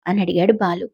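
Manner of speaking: plain reading voice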